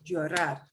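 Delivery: Llorar with the final -rar punched a bit harder; stress on last syllable. Shown as a demonstration. Teacher models Llorar for a struggling student, last syllable punched. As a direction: lean on the -rar at the end of Llorar. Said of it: "punch the last syllable a little bit harder".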